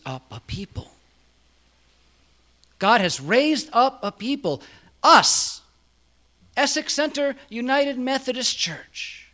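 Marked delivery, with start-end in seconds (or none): 2.8-9.3 higher and louder, climbing to a shout on us; a loud, soaring pulpit climax; rising preacher's cry. Acted. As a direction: lift the refrain into a triumphant call. Repeated refrain builds to climax.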